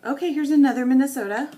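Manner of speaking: hamming up being tired of the same state; mock exasperated sigh-out. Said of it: "I just kind of wanna go".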